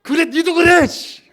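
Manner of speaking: high, loud mock comeback; angry retort. Believer hits back at the accuser, high and angry. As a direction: throw the insult straight back in play-acted anger. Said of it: "이러지 마시고".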